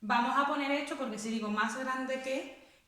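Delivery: usual speaking voice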